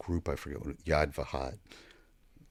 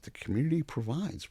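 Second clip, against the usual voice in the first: incredulous echo; 10 semitones higher than usual. Retold or imitated. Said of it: "I said".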